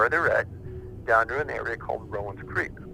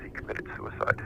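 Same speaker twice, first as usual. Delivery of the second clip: dropped to a whisper; hushed; 7 dB quieter than usual. Retold or imitated. The father revealing the agent's suicide, whispered. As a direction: let the words fall away to a breath. Grim revelation about FBI agent.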